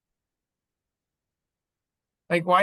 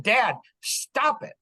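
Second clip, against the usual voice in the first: higher, slow, spaced-out command; measured, mildly insistent order, pitched up; imitating firm son; 8 semitones higher, slower than usual. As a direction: lightly act out a son cutting through dad's panic. Voices son halting his panic.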